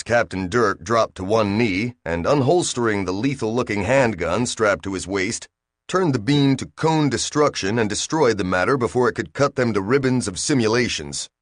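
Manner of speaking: ordinary delivery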